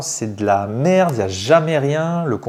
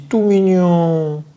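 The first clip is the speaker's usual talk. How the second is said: slow, higher coo, last syllable stretched; drawn-out cooing; 4 semitones higher, much slower than usual. Real, not acted. Unboxer admiring a cute card, drawn-out cooing. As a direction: coo slowly over something adorable.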